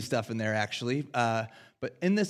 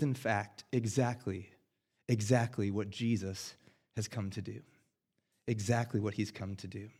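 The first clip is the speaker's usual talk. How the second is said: ordinary delivery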